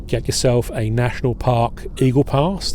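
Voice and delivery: normal talking voice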